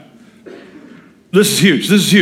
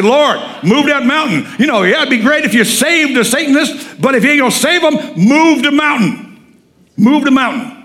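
high, punchy plea to God; insistent prayer; 8 semitones higher than usual. Retold or imitated. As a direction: pray it hard with raised pitch, slowing on the command. Acted prayer pointing at the neighbour.

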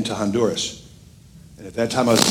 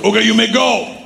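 lightly mimicked soldier, pitched up; soldier granting passage; 7 semitones higher than usual. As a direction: imitate an official waving them through, higher. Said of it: "He goes".

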